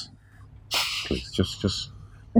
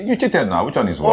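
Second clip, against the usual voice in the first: high, loud accusation; accusing shout; 9 semitones higher, 5 dB louder than usual. Acted. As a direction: call him a cheat. Accusing rival at game.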